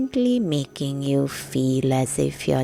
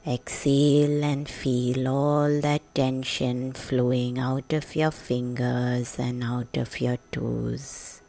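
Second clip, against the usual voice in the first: ordinary delivery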